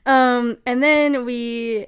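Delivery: usual speaking voice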